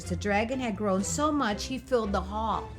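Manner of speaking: ordinary delivery, nothing marked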